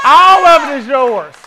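loud, slow, emphatic; all of it hammered out loud and slow; preaching shout. Real, not acted. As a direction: shout it to the church. Preacher repeats line, shouting.

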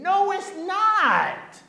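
long, high rebuttal in a mock argument; emphatic contradiction. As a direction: contradict them slowly, stretching each word.

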